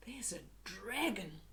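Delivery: hushed, secretive whisper. Acted. Hushed dragon reveal.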